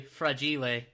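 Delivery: "fragile" said slowly as fra-JEE-lay; mock-Italian pronunciation. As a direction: stretch "fragile" into three fake-Italian syllables. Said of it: "must be Italian".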